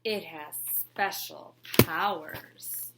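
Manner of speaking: drawn-out, sly secret; a trickster hooking his mark; mysterious and slow. Acted. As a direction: lean in and tease the magic.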